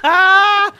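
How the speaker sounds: high, full-volume game scream; loud scream. Real, not acted. Player caught with the buzzer, screaming high and loud. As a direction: scream out as the buzzer catches you.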